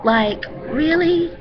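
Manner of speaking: incredulous. Real, not acted. Outraged at tomb raiding.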